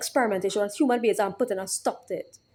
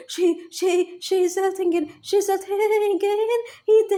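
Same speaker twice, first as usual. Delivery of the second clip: mocking the whispering voices; 9 semitones higher, slower than usual. Imitating the voices that claim she thinks he's dead, high and taunting. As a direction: sneer their words back in a high voice. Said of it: "let me address these whispering, whispering voices".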